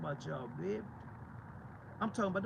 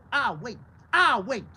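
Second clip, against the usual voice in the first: louder each time, daring them; loud dare; 5 semitones higher, 13 dB louder, slower than usual. Real, not acted. Preacher daring church folk to answer, loud. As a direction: throw the challenge out loud.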